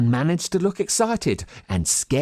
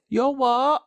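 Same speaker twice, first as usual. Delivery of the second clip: puzzled; 11 semitones higher than usual. A narrator's reading. Boy puzzled reply.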